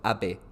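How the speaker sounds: Abe in two short syllables, AH-beh. Shown as a demonstration. Streamer working out a Japanese name, Abe in short syllables. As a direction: say "Abe" as AH-beh, no long vowels. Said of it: "they're all short".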